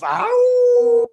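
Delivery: very high, loud mock wolf howl; hammed-up wolf howl. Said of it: "I am wolf".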